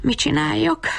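high-pitched, wondering question; pondering. A narrator's reading. Bab Berci unsure about the stone's wish, pondering. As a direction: wonder aloud what to do. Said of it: "tűnődött Bab Berci".

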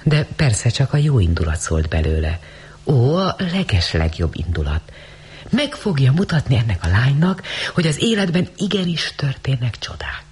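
ordinary delivery

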